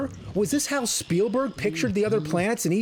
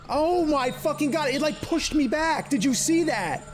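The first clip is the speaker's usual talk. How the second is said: high-pitched exasperated protest; outraged disbelief; 10 semitones higher than usual. Real, not acted. Player shoved back by an enemy, outraged. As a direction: protest it high, appalled at the cheat.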